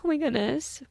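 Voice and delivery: high, flustered "Oh my goodness"; mock overwhelmed exclamation; flustered gasp. Podcaster imitating her own flustered reaction, high and breathless. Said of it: "I get a little vulnerable 'cause I'm".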